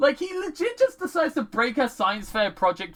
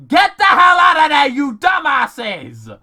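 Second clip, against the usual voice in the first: exasperated shout; 6 semitones higher, 10 dB louder than usual. Acted. Viewer yelling at the TV crew to flee, exasperated shout. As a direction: yell it at clueless fools.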